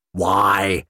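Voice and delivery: forceful, impatient question; demanding. A narrator's reading. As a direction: demand an answer. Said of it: "McCavity demanded".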